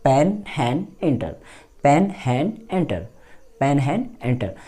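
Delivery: पेन हैन एंटर as a rhythmic drill; drill pen han enter. Shown as a demonstration. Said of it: "आप इस तरीके से बोलिए". (पेन, हैन, एंटर को लय में दोहराइए)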